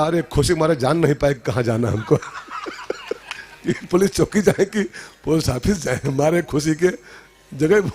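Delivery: giggling through the excuse; laughing groom. Acted. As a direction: chuckle it out, too happy to think. (खुशी से हँसता दूल्हा)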